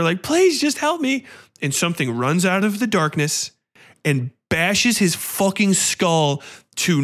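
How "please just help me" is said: screaming plea. Retold or imitated. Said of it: "he screams at her".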